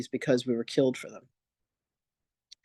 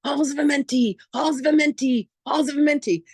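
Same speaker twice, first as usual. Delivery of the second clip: much higher, chanted cries of Amenti over and over; screaming spirit beings; 12 semitones higher than usual. Retold or imitated. Excited beings in the records scream at her, high chant. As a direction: cry it out like excited otherworldly beings jumping about. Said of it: "and they're screaming".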